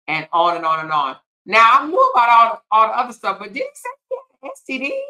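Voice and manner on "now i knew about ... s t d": shocked. Real, not acted. Shocked at news in chat.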